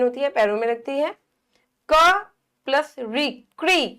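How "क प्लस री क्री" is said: क and री said slowly apart, then fused as क्री; blended sounds. Shown as a demonstration. Teacher showing pupils how sounds join, क्री sounded out slowly. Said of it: "क और री दोनों की आवाज आ जाए".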